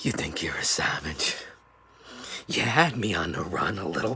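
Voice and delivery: low whispered jab at the rival; menacing whisper. Acted. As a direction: whisper it like a threat. Menacing taunt after laugh.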